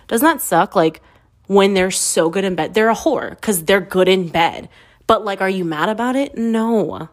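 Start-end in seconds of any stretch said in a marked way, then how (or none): none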